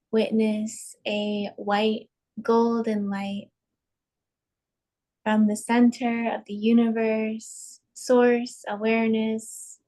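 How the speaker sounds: slow, spacious meditation voice with long pauses; unhurried, evenly pitched breathing guidance; guided meditation. Acted. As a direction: guide listeners calmly into each breath.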